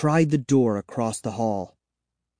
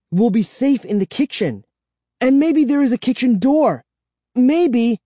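hopeful; 12 semitones higher, 5 dB louder than usual. Acted. Narrator hopeful plan.

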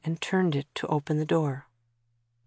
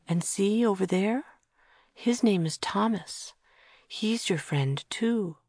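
soothing; 3 semitones higher, 4 dB quieter, much slower than usual. Acted. Rocking and soothing a child.

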